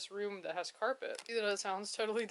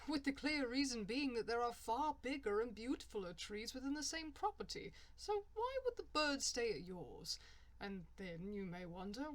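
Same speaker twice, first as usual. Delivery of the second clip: a hammed-up English accent for a fake tree advert; posh English ad voice, played lightly; mock English accent; 3 semitones higher than usual. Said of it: "horrible English accent man talking about trees".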